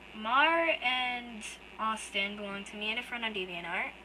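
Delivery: ordinary delivery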